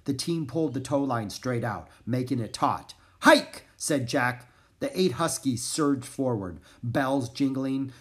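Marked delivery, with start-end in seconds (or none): 3.2-3.7 sharp, loud, high call; shouted command. A narrator's reading. Jack starting the huskies off, shouted command. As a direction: holler it to the dogs. Driver calls out to dogs.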